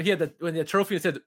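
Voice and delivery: ordinary talk, nothing marked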